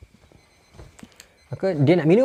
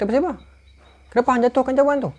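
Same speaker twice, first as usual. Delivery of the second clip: high, louder rebuke; sharp demand; 10 semitones higher, 3 dB louder, faster than usual. Acted. Umar confronting Hormuzan about the dropped cup, high sharp rebuke. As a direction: call out the trick.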